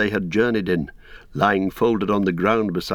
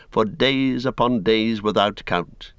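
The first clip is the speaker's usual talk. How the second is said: ordinary delivery, nothing marked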